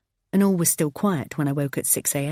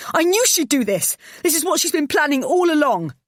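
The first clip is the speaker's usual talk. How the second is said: high, loud frantic shouting; frantic accusation; 11 semitones higher, 5 dB louder than usual. Acted. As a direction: rant it out in panic. Frantic mother accusing mother-in-law.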